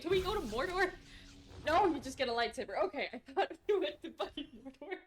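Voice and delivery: high, amused self-mocking tone; real giggle at her own mix-up; laughing confusion. Real, not acted. Gamer laughing at mixing up Mordor and lightsabers.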